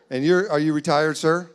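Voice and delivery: normal talking voice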